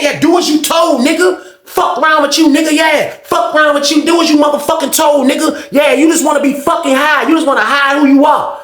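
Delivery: high, jeering holler; taunting tirade. Retold or imitated. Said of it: "he talking to him like that".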